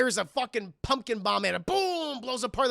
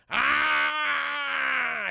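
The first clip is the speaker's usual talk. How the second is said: mock falling yell; 5 semitones higher than usual. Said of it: "a weird sitting fall".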